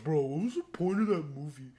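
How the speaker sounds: loud put-on complaint; mocking imitation. Moviegoer griping about the point of the movie, mock complaint. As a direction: exaggerate it like a baffled bro. Acts out red-flag viewer complaint.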